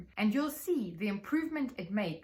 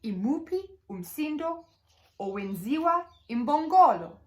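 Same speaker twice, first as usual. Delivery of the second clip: put-on reading a story to children; 5 semitones higher, 7 dB louder than usual. Shown as a demonstration. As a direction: lively question to a class, rising at the end. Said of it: "Here we created a story".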